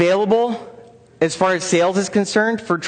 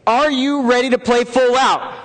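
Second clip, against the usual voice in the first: raised-pitch rallying shout to the room; powerful, enthusiastic call; 5 semitones higher than usual. Shown as a demonstration. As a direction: fire up the crowd with a big question. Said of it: "loud, enthusiastic yes".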